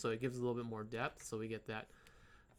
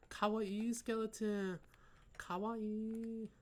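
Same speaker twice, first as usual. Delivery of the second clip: high, slow gushing fangirl voice; excited anime fan; 8 semitones higher, much slower than usual. Pretend. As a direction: swoon over how cute it is. Gushing over kawaii skeleton.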